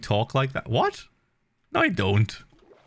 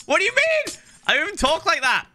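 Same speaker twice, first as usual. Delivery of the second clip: much higher, squeaky indignant denial; indignant protest. Real, not acted. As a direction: protest in a high pitch, a bit faster. Protesting the diss track impression.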